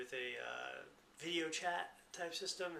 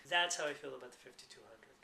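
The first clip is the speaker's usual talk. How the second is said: usual speaking voice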